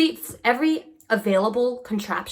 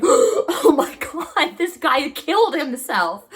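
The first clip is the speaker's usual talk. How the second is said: higher, slow gasped alarm; mock shock; 7 semitones higher, 4 dB louder, slower than usual. Passengers gasping as dancer hits head, mock shock. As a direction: act out the passengers' horror. Riders' shocked reaction retold.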